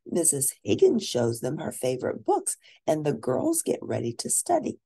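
ordinary delivery, nothing marked

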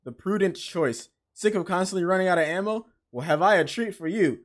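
usual speaking voice

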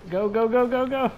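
high, loud frantic urging; excited cheering. Real, not acted. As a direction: yell them on toward the goal. Gamer urging a shot in match.

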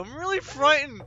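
real fear fading; frightened. Real, not acted. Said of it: "I'm really frightened right now".

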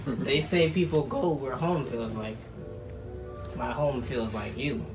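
soft, sincere and heartfelt; tender proposal speech. Real, not acted. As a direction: speak softly, from the heart.

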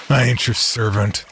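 plain reading voice

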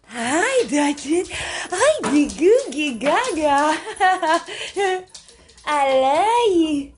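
high, slow cooing with a giggle. Real, not acted. Owner greets the dogs Dutchman and Goo Gaga, high baby talk. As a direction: dote on the dogs, sing-song and slow.

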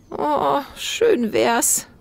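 put-on chuckling old woman; laughing grandma. A narrator's reading. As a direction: laugh it off warmly. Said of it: "lachte Oma". (lachend, gutmütige Oma)

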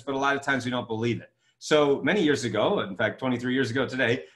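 ordinary talk, nothing marked

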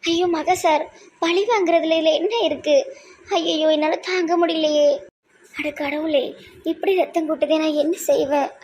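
high, anguished wailing; crying out in panic; tearful distress. Acted. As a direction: lament as if helpless at the sight of blood. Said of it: "ஏன் அழுறீங்க?".

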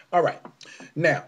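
usual speaking voice

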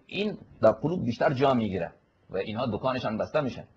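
ordinary talk, nothing marked

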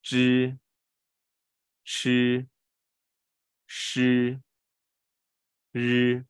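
吱, 吃, 诗, 日 high and level, each held; retroflex drill. Shown as a demonstration. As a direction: chant out 吱, 吃, 诗, 日 with pauses between. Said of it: "可以再试一次啊".

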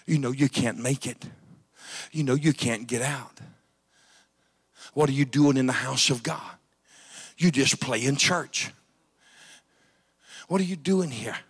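the accuser taunting a churchgoer, softly; the enemy's taunting voice. Acted. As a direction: hiss a soft taunt like the devil in their ear. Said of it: "That's what it'll tell you".

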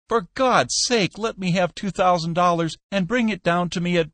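high, pleading, worn-out voice; desperate exhausted Peck. A narrator's reading. Peck begs Skinner on the phone, high and desperate. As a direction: beg for the money at the end of your rope.

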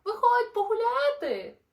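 high, loud friendly shout up a tree; calling out. Acted. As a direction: yell up to the branch to come play. Wombat calls up to Kevin.

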